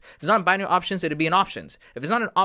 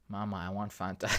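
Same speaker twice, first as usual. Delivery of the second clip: put-on much softer, childish little plea; mock baby voice; 8 semitones lower, 12 dB quieter than usual. Mocking grown traders to his viewers, childish little plea. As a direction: play a grown man begging like a toddler. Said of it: "you sound like a baby".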